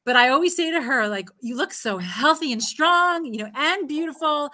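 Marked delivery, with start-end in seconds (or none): 1.4-4.5 encouraging praise. Retold or imitated. Mother voicing praise to daughter.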